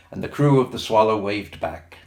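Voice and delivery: ordinary delivery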